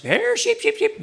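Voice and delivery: mock sheep call, leaping up high; shepherd calling sheep. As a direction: call out to a flock, making it up. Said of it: "they call out to the sheep".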